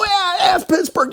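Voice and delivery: very high, whooping retort; mock holler back. Host answering a fan in town, high mock shout. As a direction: holler it back in pretend defiance.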